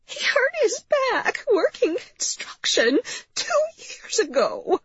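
high, slow, whispered whimper; tearful whisper. A narrator's reading. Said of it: "she whispered".

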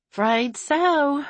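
high, sighed admission; resigned sigh. A narrator's reading. Hairdresser admitting the party line is back, resigned. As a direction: sigh it out with mild regret. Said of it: "Beulah sighed".

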